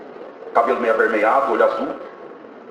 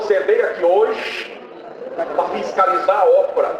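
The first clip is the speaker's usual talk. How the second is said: retold preaching; 7 semitones higher than usual. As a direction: imitate a preacher calling someone out, stretching the words. Church leader preaches pointing at him.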